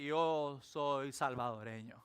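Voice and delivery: slow, low, mildly grand declaration; drawn-out mock proclamation; mock-solemn Jonah. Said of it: "como Jonás dije".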